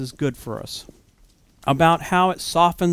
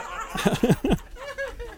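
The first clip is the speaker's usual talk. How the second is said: high burst of laughter at the memory of the mix-up; a giggling fit over mispronouncing Boaz; laughing at the blunder; 6 dB quieter than usual. Retold or imitated. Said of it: "So I was like".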